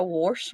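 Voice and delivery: "wash" with an added r, like "warsh"; drawn-out "wash", said as "worsh"; regional pronunciation. Retold or imitated. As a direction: put an r before the sh in "wash". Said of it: "what I don't understand is some people say".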